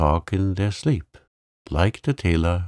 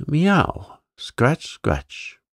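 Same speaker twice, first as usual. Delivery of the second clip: mew, then scratch at the sill; scuffling cat; 7 semitones higher, much slower than usual. A narrator's reading. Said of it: "scuffled Simpkin".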